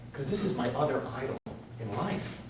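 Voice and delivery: normal talking voice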